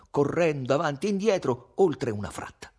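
usual speaking voice

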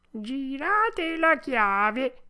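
slow, high, singsong little voice. A narrator's reading. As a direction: instruct visitors in a tiny drawn-out voice. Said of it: "disse la solita vocina". (vocina acuta, lenta e cantilenante)

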